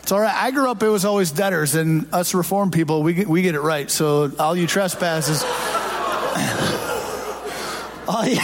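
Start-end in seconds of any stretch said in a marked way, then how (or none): 5.5-8.0 soft unvoiced chuckle into the mic; breathy laugh. Real, not acted. Pastor laughing at his trespasses joke, soft chuckle. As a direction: laugh quietly at your own joke.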